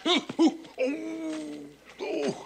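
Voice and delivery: high yelps of tooth pain; sharp cries of pain; pained ooh. Acted.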